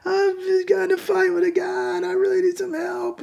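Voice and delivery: high-pitched mock plea of a drunk woman; drunk, tearful caller. Drunk woman phoning for help, high tearful imitation. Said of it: "she's drunk and she's still kinda crying".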